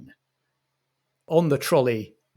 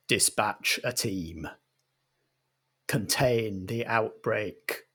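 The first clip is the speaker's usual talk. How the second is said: slow, softer, clipped command; cold agent order; 2 semitones lower, 4 dB quieter, slower than usual. Acted. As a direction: give the order coolly, slowly. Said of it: "The other agent says".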